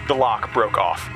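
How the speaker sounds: ordinary talk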